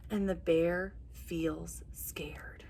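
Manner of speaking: slow hushed whisper; scared whisper. A narrator's reading. Teacher hushing the refrain for the children, slow whisper. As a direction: breathe it out like a spooky secret.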